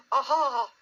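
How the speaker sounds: high, squeaky attempt at an aha; feeble aha. A narrator's reading. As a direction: try a triumphant aha in a small high voice. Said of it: "as well as he could after his terrifying journey".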